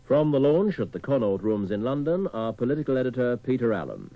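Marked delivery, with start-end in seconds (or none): none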